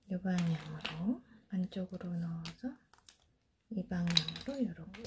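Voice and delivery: soft unvoiced whisper while demonstrating; hushed how-to whisper; whispered instructions. Real, not acted. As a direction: whisper each step as you work with your hands.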